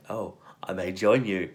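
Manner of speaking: a hint of a flirty English charmer; imitating Fletcher. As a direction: tease him, sly and suggestive. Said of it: "And it's like".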